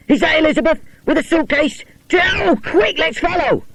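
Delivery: put-on high, eager boy's voice; excited. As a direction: urge the others to chase. Boys chase the girl.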